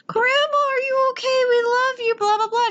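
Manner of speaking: high, sing-song mock gushing; hammed-up high-pitched concern. Retelling rushing in to grieving grandma.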